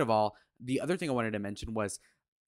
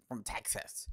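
whispered sneering quote; mock whisper; 3 semitones lower, 12 dB quieter, slower than usual. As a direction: breathe it out mockingly. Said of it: "Bro says".

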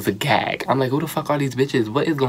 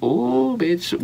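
excited squeal; 12 semitones higher than usual. Retold or imitated. Hyped reaction to queens' looks.

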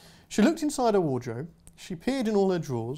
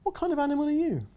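put-on quick, higher child's question; curious little girl; 10 semitones higher, faster than usual. Girl quizzing the aardvark, quick and curious. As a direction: blurt it out curiously.

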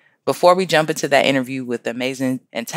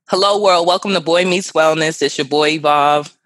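high, loud hello to the listeners; show-opener greeting; 3 semitones higher, 4 dB louder than usual. Real, not acted. Host welcoming listeners to the wellness podcast, high, loud show-opener greeting. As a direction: open the show big and high, easing down slightly.

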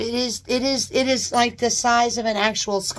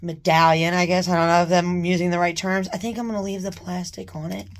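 ordinary talk, nothing marked

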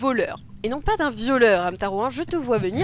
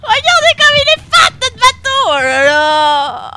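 excited; 11 semitones higher, 9 dB louder than usual. Acted. Marvelling at the ship.

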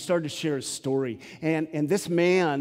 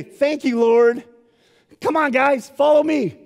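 eager escapee; 10 semitones higher, 9 dB louder than usual. Pretend. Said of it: "Paul had every opportunity to say".

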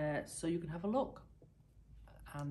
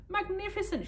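much higher shout; 3 dB louder than usual. Acted. Agriculture minister showing off, high shouted praise. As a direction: throw it out in showy praise. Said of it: "shouted the agriculture minister".

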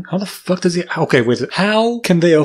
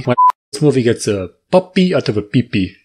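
normal talking voice

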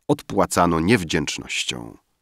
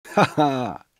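high-pitched acted "ha, ha"; exclaimed laugh; 7 semitones higher than usual. A narrator's reading. Drunken Rafael suddenly remembers his talisman, exclaimed high laugh. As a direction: cry out a high, exultant laugh. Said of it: "wykrzyknął Rafael".

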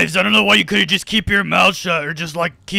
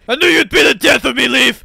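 louder, higher angry outburst; angry shout; 6 semitones higher, 3 dB louder than usual. Acted. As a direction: yell it at your son. Dad furious at son.

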